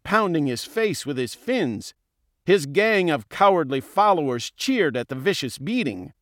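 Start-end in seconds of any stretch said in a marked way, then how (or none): none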